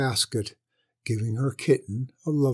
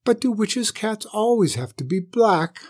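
high, pleading question; meek; 8 semitones higher than usual. A narrator's reading. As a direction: plead meekly in a raised voice. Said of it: "the mother cat said meekly".

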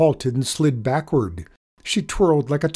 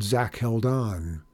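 plain reading voice

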